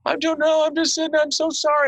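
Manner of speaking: high, panicked little voice, sorry tumbling out; flustered apology. Retold or imitated. Telemarketer apologizing to a supposed agent, high and panicky. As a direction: play the scared caller backpedalling fast. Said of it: "She goes".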